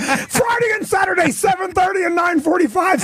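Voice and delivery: high-pitched, worked-up yelling; mock frantic shouting. Comic imitates his club boss cornering him, mock frantic shouting. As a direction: yell it high, like a boss demanding a plug.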